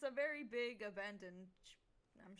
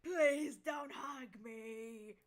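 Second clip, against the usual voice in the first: loud, very slow wail; shouted plea; 3 semitones higher, 6 dB louder, much slower than usual. Acted. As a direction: drag it out in a shout. Axe begging, shouted.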